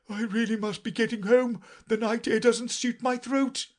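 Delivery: high, loud fussy bird; fussy old magpie. A narrator's reading. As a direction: hurry off with excuses. Old magpie excuses itself.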